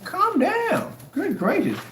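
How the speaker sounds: mock calm down. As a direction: lampoon him as fussy and flustered. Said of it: "Just up there going".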